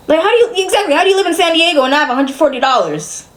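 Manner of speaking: fast, high burst of disbelief; incredulous venting. Real, not acted. Daughter asking how her mother lacks the money, incredulous.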